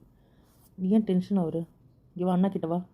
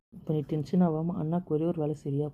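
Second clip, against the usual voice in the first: normal talking voice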